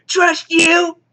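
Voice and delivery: high, loud whiny yell; mock tearful outburst. X-Man throwing her teammate's advice back, mock tearful yell. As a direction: wail it in hammed-up disbelief. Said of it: "she starts crying".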